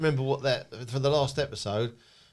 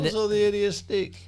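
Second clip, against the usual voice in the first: catchphrase voice; 8 semitones higher than usual. Retold or imitated. Quoting Big Night Out catchphrase.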